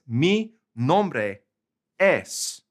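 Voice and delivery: mi nombre es, said mee NOM-bray ess; Spanish mi nombre es. Shown as a demonstration. Teacher giving the second way to say my name. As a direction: model mi nombre es carefully. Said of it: "literally say".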